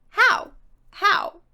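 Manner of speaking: incredulous, throwing up her hands; exasperated how. Real, not acted. Reviewer baffled by the characters' dumb plan, exasperated.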